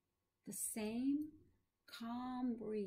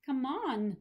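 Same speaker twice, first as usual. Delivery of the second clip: louder, higher nervous interjection; nervous protest; 4 semitones higher, 8 dB louder than usual. A narrator's reading. Visitor brushing off his professor's talk of dying, nervous protest. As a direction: push it out nervously, a little too loud. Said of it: "I said nervously".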